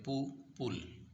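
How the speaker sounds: पू, पुल drawn out slowly with a plain oo sound; Turkish u as oo. Shown as a demonstration. Teacher to students, पू, पुल said slowly with a long oo. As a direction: stretch the oo in पू, पुल. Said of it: "यू को ऊ पढ़ते हैं".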